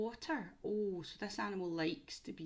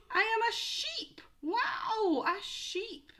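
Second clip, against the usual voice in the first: much higher, louder sheep reveal; excited reveal; 12 semitones higher, 4 dB louder, slower than usual. Shown as a demonstration. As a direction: act amazed for the children.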